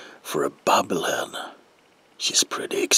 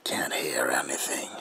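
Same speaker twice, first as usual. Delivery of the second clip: ordinary delivery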